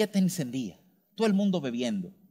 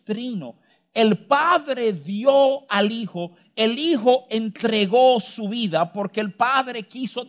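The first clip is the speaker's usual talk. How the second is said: higher, louder, driving sermon voice; full-force preaching; 6 semitones higher, 6 dB louder than usual. Acted. Preacher pressing the congregation to trust God, full force.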